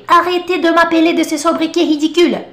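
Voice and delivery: a touch of an indignant character; quoted book line. Retold or imitated. Teacher quoting Le Petit Nicolas character, lightly indignant. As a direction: mildly voice an annoyed teacher. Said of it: "uma frase clássica é".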